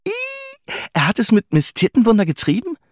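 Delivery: high, loud grossed-out question; disgusted surprise. A narrator's reading. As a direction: squeal it in amused disgust. Wife disgusted and amazed.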